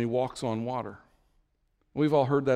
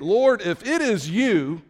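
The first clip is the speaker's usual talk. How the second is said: loud, high, drawn-out call; excited Peter; 6 semitones higher, 5 dB louder, slower than usual. Acted. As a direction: call out eagerly, stretching the words. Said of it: "Peter gets excited".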